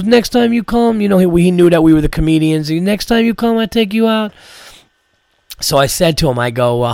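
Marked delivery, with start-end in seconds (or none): 0.0-1.0 Armenian accent impression. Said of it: "He goes". 2.8-4.3 Armenian accent impression. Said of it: "He goes".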